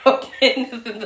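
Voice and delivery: ordinary talk, nothing marked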